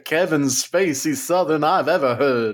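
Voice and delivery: comment in a put-on Kevin Spacey Southern accent; mock genteel Southern.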